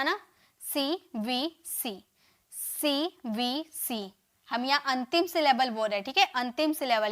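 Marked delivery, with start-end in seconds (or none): 0.6-4.1 very slow "CVC", a pause after each letter. Shown as a demonstration. Teacher pointing out the letter pattern, each letter slow and separate. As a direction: spell out सी, वी, सी one at a time. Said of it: "CVC से पूरा हो रहा".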